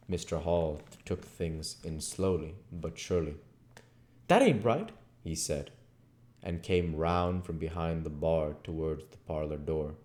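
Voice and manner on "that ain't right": rustic landlord. Acted. Rustic landlord voiced in reading.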